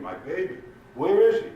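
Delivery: high, frantic cry rising to loud; acted scream, climbing in pitch and volume; screaming grandmother. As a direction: cry out for a lost child. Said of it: "she started screaming".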